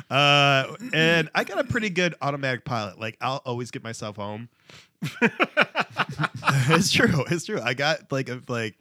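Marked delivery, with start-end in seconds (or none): 5.0-7.2 laughing it out, louder; giggling insistence. Real, not acted. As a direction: say it through a laugh.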